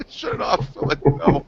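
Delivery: high, breaking-up laughter through the words; laughing. Real, not acted. Gamer retelling how he shut it off, laughing. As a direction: barely get it out for laughing.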